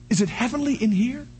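normal talking voice

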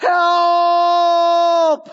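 long, high-pitched cry of help; demonstrative cry for help, stretched out. Shown as a demonstration. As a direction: hold one drawn-out call, pitched high and sustained. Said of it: "when we cry out, what do we say".

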